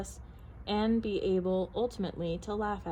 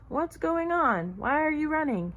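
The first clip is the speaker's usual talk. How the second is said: sleepy bear woken; 6 semitones higher, 4 dB louder than usual. A narrator's reading. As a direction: call out in bewilderment. Sleepy bear questions the runners.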